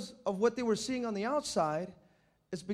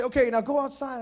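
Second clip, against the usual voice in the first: louder, higher mom sending you out; mom's order; 4 semitones higher, 8 dB louder than usual. Retold or imitated. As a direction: call it out like a mom shooing a kid outside.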